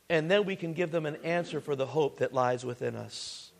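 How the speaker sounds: ordinary talk, nothing marked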